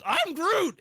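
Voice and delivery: high, loud mock Groot line; imitating Groot. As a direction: squeeze it out like the tree creature. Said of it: "he goes".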